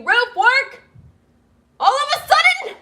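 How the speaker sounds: building to a high shout; loud outburst. Real, not acted. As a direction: yell up at the noisy roof workers. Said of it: "I'm a loud person".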